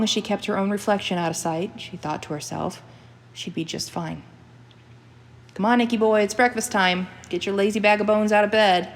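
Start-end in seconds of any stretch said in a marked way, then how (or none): 5.5-8.9 a loud, high call to the dog upstairs; calling up the stairs. Acted. Jeanette calls up the stairs to wake the dog, loudly. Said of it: "she called up the stairs".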